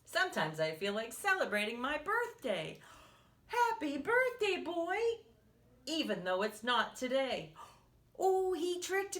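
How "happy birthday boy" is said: high, louder happy cheer; excited greeting. Acted. Teacher cheering the birthday boy in the book, excited.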